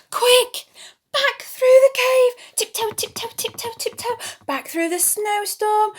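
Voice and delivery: panicked rush. A narrator's reading. Children's storyteller fleeing the bear, urgent and high. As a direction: hurry everyone away.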